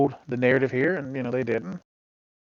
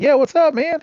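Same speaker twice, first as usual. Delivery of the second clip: much higher, louder retold greeting; upbeat greeting; 6 dB louder than usual. Player answering his division leader, upbeat greeting. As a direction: call it out high and loud like greeting a buddy.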